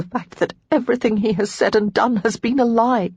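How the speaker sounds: high, shaking, half-whispered reply; a wavering, hurt answer; shakily. Acted. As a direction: let the voice tremble. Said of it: "before replying shakily".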